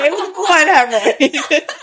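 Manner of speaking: high, slow, amused insistence; laughing defensiveness. Real, not acted. New mother teased about hemorrhoids, laughing insistence. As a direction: draw it out through a laugh. Mock-defensive reply to teasing.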